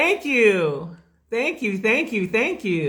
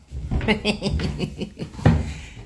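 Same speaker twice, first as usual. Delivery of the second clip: long breathy chuckle; a run of quick, airy chuckles; 2 semitones lower, 5 dB quieter than usual. Real, not acted. Greeting the viewers before going live, chuckle.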